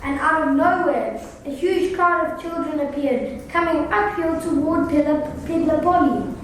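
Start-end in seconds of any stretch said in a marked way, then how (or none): none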